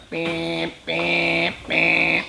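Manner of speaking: soft beep beep like a fax machine; mock fax beeps. Telling young listeners how a fax machine sounded, soft mock beeps. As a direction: imitate the machine's beeps, quiet at first.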